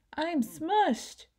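high, strained little dinosaur voice; a cramped toy dinosaur complaining; squashed dinosaur. A narrator's reading.

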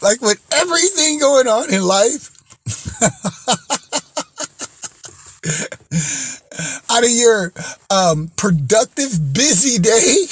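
talking up high through giggles; words bubbling with laughter; laughing. Real, not acted.

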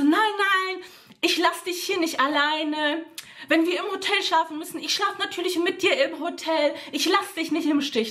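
Freundin höher nachmachen, beteuernd; nachgemachte Freundin. Retold or imitated. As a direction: die Freundin spielen, die übertrieben beteuert. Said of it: "Aber sie so". (leicht gespielte Freundin, höher und beteuernd)

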